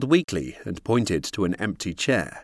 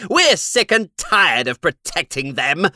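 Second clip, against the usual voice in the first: cruel; 7 semitones higher, 5 dB louder than usual. A narrator's reading. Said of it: "said Vaini with a cruel".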